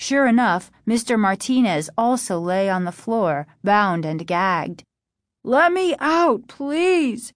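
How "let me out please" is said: high, drawn-out plea; desperate cry. A narrator's reading. Trapped father begging his daughter, desperate cry. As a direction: cry out to be freed, stretching the words. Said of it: "her father cried".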